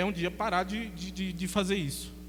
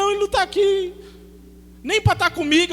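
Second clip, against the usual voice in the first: panicked cry; 11 semitones higher, 7 dB louder than usual. Retold or imitated. Woman panics about her son.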